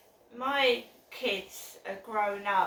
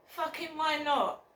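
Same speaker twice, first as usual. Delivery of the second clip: higher angry snap, imitating texter; angry retort; 7 semitones higher than usual. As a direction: spit it back like an offended stranger. Retelling texter's angry reply.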